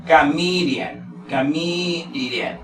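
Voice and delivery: very slow, stretched "chameleon"; pronunciation demo. Shown as a demonstration. Teacher models a four-syllable word, "chameleon" slowly sounded out. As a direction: draw out each syllable of "chameleon". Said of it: "la pronunciación aquí sería".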